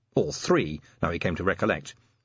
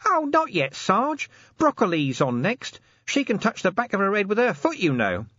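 high-pitched, chatty explanation; cheeky corporal justifying himself; Nobby, cheeky corporal; 11 semitones higher than usual. A narrator's reading. Nobby voiced in story.

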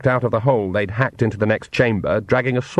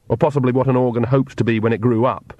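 plain reading voice

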